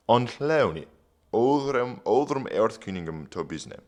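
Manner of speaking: put-on reading Old English aloud; careful Old English recitation. Shown as a demonstration. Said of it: "reading Old English aloud".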